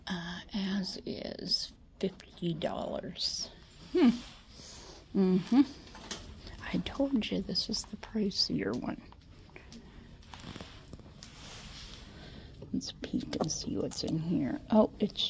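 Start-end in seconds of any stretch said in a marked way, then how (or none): 0.1-3.5 slow hushed whisper about the price. Real, not acted. Shopper whispering a price to her viewers, slow and hushed. Quiet remark in a shop. 6.7-14.6 hushed aside to the camera; whisper. Real, not acted. Vlogger whispering to viewers while browsing the pricey thrift.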